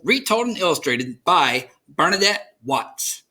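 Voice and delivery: plain reading voice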